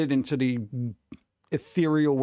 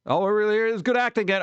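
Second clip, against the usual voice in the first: mock gushing; 8 semitones higher, 3 dB louder, faster than usual. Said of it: "seesaw of just like".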